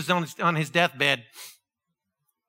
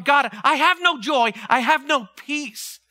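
pleading with God; 7 semitones higher, 5 dB louder, slower than usual. Retold or imitated. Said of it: "And I'm going".

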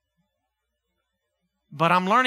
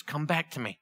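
gentle voice of God; 4 semitones lower, 6 dB quieter, faster than usual. Acted. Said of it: "And he says".

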